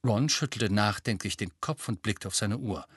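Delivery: usual speaking voice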